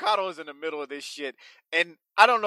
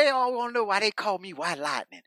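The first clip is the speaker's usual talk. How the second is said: higher mock promo voice; hammed-up wrestler boast, higher; 5 semitones higher, 4 dB quieter than usual. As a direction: pitch it up like a cocky promo. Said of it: "He goes, he goes".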